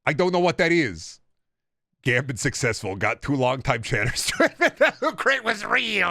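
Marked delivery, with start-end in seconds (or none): 0.1-1.2 meme line. Retold or imitated. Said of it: "Corporate needs you". 4.6-6.1 high, slow, laughing squeal; giggly high exclamation. Real, not acted. Laughing silly voice.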